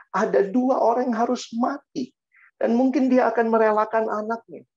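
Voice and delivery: high, forceful preacher's voice; impassioned preaching. Acted. Preacher on a mother giving up her son, impassioned preaching. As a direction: drive the point home to the congregation.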